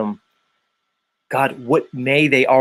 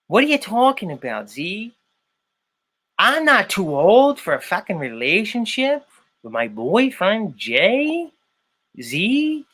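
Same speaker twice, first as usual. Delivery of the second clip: high exaggerated Chicago impression; mock Chicago accent; 5 semitones higher than usual. Host does a perfect impression of his friend. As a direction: pitch it up and lay the Chicago accent on thick. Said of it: "I'm doing a perfect impre".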